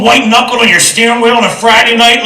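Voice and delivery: ordinary delivery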